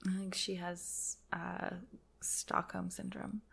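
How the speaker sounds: whispered aside, very soft; hushed whisper. Real, not acted. Answering co-host on whether she's falling in love, whispered. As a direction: whisper it like a guilty confession.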